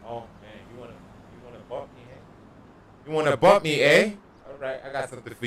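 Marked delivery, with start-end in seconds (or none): 0.1-3.1 soft sinister taunt; menacing hush. Acted. Taunting the player who bumped him. 3.1-4.1 louder, higher shouted challenge; shouting down a rival; loud taunt. Acted. Taunting the player who bumped him.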